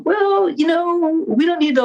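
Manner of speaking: lampoon the people saying it; sarcastic impression of a too-easy missions attitude; mocking quote.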